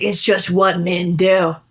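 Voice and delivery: lightly voiced macho dude; a hint of a swaggering man; mock macho guy. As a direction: drop into a knowing bro tone. Quoting machete man's line.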